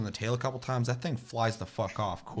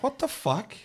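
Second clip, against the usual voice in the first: mock disbelief; 14 semitones higher, slower than usual. Retelling surprise at hammer.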